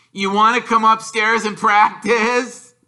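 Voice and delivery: high, loud jeering bully voice; Dudley's taunt. Acted. Dudley teasing Harry, high jeering bully voice. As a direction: sneer it like a bully.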